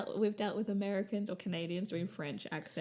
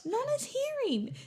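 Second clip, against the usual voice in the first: said again, much higher; repeated louder, higher; slower than usual. Real, not acted. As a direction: repeat it higher so she hears.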